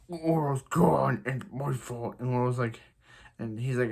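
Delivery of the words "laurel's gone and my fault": slow, drawn-out mock Batman growl; hammed-up tortured superhero voice; Batman impression. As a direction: groan it out like a brooding parody Batman. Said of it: "Every character does the best Batman impression".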